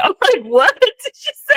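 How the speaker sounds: high-pitched, breaking up with laughter; laughing disbelief. Real, not acted. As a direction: laugh through the baffled question. Cracking up at garbled statement.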